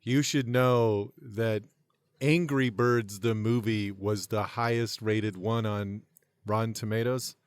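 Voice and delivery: ordinary talk, nothing marked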